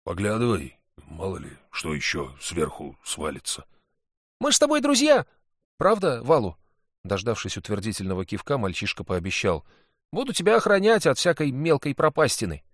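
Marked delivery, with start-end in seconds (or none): 0.0-3.7 lightly voiced big hunter; a hint of a relieved big man; hunter's voice. Valu warns the boy. 4.4-6.6 put-on higher, louder excited boy; boy's voice. Sid cheerfully to Valu. 10.1-12.6 put-on higher, louder excited boy; boy's voice. Sid promises to guard Valu.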